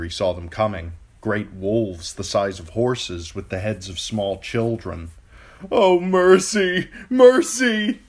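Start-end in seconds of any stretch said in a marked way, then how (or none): 5.7-8.0 very high, loud, drawn-out wail; desperate pleading. A narrator's reading. Theon wailing at the wolves, slow terrified pleading. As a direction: wail for mercy in terror.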